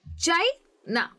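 "China" slowly split, strong high CHAI, pause, NA; slow syllable demo of "China", CHAI stressed; stress on first syllable. Shown as a demonstration. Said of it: "चाय पे ज़्यादा stress".

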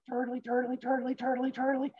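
high, small, steady chant; squeaky turtle trundling along; plodding turtle. Acted. Turtle keeps on walking past the flirting, high small chant.

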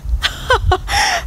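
high, breathy mock laughter; acted lion laugh. A narrator's reading. Lion laughing at the tiny mouse, acted laugh. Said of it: "he began to laugh".

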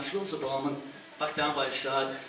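ordinary talk

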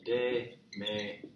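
slow, separated "Démelo"; teacher's slow word breakdown; syllable by syllable. Shown as a demonstration. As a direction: sound out "Démelo" one drawn-out syllable at a time. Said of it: "Give it to me".

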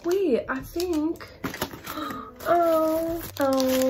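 high, slow wait then gasp; dawning surprise. Real, not acted. Finding the matching brand, high slow surprise. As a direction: let the discovery catch your breath.

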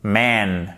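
MAN with an open English short A, drawn out. Shown as a demonstration. Teacher models the English word for French learners, English short A. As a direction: say MAN from the back of the mouth, long vowel. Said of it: "c'est plus un A".

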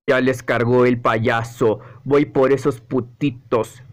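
slow, deep threat; menacing villain. Acted. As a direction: announce the hunt low and slow. Villain comes after them.

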